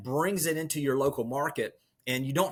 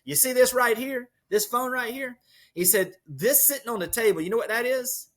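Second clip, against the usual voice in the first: put-on lively retelling, no accent; 6 semitones higher than usual. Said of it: "I'm not gonna use his Louisiana accent".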